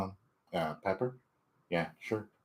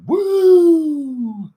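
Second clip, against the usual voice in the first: loud high whoop; high cheering woo; 9 dB louder than usual. Real, not acted. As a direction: whoop with delight. Celebrating on stream.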